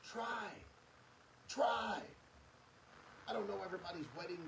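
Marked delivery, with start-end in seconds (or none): 1.5-2.0 "Try." said louder and punched; emphatic plea. Real, not acted. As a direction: push "Try." hard, like a demand. Urging couples to keep trying.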